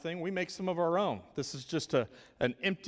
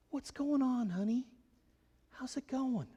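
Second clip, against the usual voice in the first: softer, higher gentle greeting; calm voice; 8 semitones higher, 3 dB quieter than usual. Shown as a demonstration. Teacher calming a worked-up four-year-old, soft and gentle. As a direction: soothe her gently, like calming a child. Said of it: "I'm gonna talk in a calm voice".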